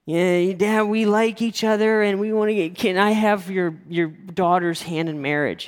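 high, halting, unprepared plea; mock your own nervous fumble, slowing toward the end; pathetic stammer. Boyfriend asking sleepy father for daughter's hand, pathetic stammer. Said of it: "it was pathetic".

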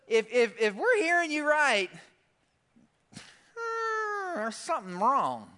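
high, sneering questioning voice; mocking accusers. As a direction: needle him like a suspicious crowd. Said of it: "They're like".

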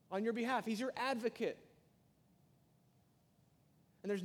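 high, loud rising preaching; full-force preaching. Acted. Preacher quoting Paul to his congregation, loud impassioned preaching. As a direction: lift the congregation with each claim.

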